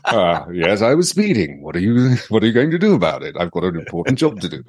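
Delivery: lightly voiced suave gentleman; breezy, unbothered tone to a cop; suave British gent. Imitating Roger Moore to police.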